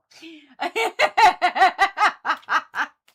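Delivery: high hearty burst of laughter; loud laugh. Real, not acted. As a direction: break into big laughter. Laughs at own joke.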